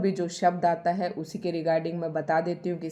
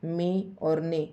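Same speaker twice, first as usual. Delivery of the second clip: मी और ने said very slowly; dictating letters slowly; slow dictation; slower than usual. Shown as a demonstration. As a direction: spell it out slowly. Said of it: "तो प द के नीचे हलंत".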